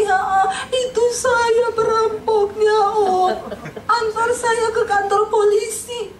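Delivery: high, slow, sobbing admission; weeping confession. Retold or imitated. Robber admitting his crime to his uncle, sobbing slowly. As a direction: break down as you confess. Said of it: "Nangis dia di rumah omnya".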